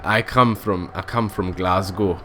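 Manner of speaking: loosely imitated Glasgow accent; attempted Scottish accent. Gamer pretending to come from Glasgow, shaky attempt at Scottish accent. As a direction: have a rough go at a Scottish accent. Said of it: "I should really just stop though with accents".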